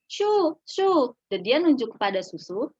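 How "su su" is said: put-on high, louder toddler "Su, su"; babbling toddler. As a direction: chirp it like a baby asking for milk. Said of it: "ketika seorang anak bilang". (anak kecil menyebut "Su, su" dengan suara tinggi)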